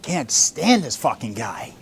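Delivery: muttered aside. Retold or imitated. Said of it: "And you're just going".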